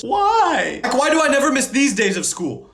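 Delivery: high, anguished complaint; exasperated wail. Acted. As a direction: wail it in mock despair. Wailing over missing the fun.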